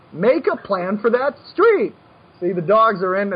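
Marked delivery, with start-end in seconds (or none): 0.1-1.9 slow, drilled-in catchphrase rising high on "street"; mock-nagging coach's mantra, drawn out; annoying slogan. Shown as a demonstration. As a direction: hammer it at students, stretched out and higher. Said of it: "if I upped how annoying I was".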